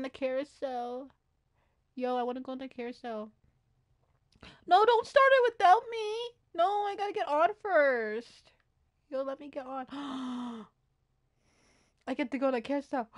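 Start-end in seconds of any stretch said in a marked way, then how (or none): 4.7-8.5 high, loud plea to wait; urgent protest. Pretend. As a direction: call out in a playful panic. Urging ride to wait for them.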